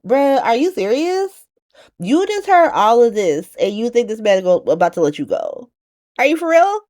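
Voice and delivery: high-pitched disbelief; incredulous. Acted. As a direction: question them as if they cannot be serious. Scolding the character's naive exit.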